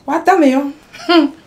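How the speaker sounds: ordinary delivery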